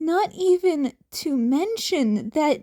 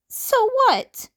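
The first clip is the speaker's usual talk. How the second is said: high, louder defensive retort; defensively; 11 semitones higher, 5 dB louder than usual. Acted. Izuku defending himself to Aizawa, high and loud. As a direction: snap back on the defensive. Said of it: "Izuku asked defensively".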